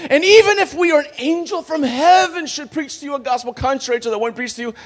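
high, loud scripture warning in one rush; impassioned apostolic rebuke; vehement Paul. Acted. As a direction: deliver the warning at full, sustained pitch. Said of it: "And then he says".

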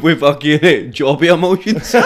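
ordinary delivery

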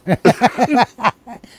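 loud burst of laughter; belting laugh. Real, not acted. Cracking up while reading.

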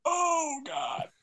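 high drawn-out mock lament dropping into a laugh; mock-anguished groan. Fan re-enacting his reaction to a sad scene, mock anguish. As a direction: wail it lightly, half laughing.

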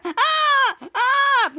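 two high mock screams; repeated cartoon yells of pain; acted screaming. Ray zapped by electricity, mock screaming. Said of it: "And he's screaming".

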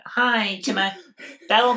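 bearded guy in a high sweet voice; mock high voice. Mocking a bearded drive-thru worker with a high voice. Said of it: "He's like".

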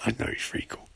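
quiet conspiratorial whisper; whispered agreement. Retold or imitated. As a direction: whisper back like a gossiping fan. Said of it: "She's like".

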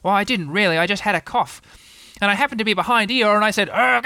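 high-pitched, protesting animal voice; lifted, excitable character voice; defensive Tigger. A narrator's reading. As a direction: explain it with injured innocence. Tigger excuses himself with a cough.

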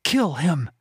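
high, sharp order from a cat; commanding cat voice. A narrator's reading. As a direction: give the order like a fierce cat. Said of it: "Nax sent a command".

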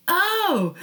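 high, surprised little oh. Retold or imitated. Hearing her friend skips for sports, a high startled oh. Deflated reaction to her excuse.

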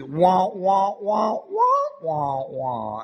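loud, rising "wah wah" like a Peanuts adult; cartoon teacher wah-wah; muffled trombone wah. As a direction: mimic unintelligible adult talk as a trombone. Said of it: "and he's".

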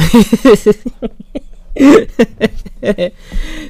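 loud high-pitched laugh. Real, not acted. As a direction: burst out laughing. Laughing at bloopers.

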